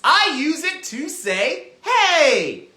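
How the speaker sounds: loud, high, stretched-out call; big projected shout. Shown as a demonstration. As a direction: holler it as if calling someone. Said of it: "This is my calling voice".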